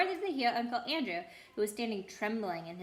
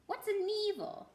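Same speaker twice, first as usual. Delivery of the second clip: squeaky animal; 11 semitones higher than usual. Acted. Animal confused by word.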